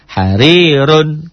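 Harirun very slowly with a full, heavy R; thick ro. Shown as a demonstration. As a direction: draw out Harirun, the R kept thick. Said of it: "tetap tebal".

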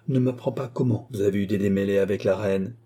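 usual speaking voice

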